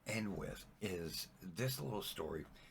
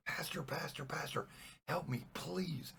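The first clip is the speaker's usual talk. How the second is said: higher, insistent begging; acted desperate plea; 8 semitones higher than usual. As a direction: plead up at a passerby, leaning on please. Trapped man begs a passing priest.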